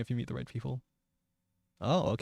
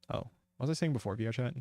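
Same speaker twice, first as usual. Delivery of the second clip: ordinary delivery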